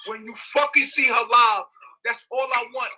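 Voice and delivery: loud pleading shout. Real, not acted. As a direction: push it out, desperate. Pleading loudly for his daughter.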